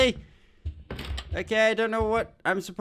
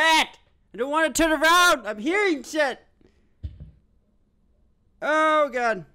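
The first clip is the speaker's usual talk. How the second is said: panicked; 10 semitones higher than usual. Real, not acted. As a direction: cry out in fear. Scared in dark horror game.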